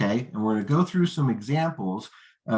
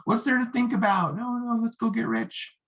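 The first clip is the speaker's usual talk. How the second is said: much higher, gushing hype; desperate salesman gushing; desperate rookie; 9 semitones higher than usual. Shown as a demonstration. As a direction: oversell it, a bit desperate. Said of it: "But that sounds desperate".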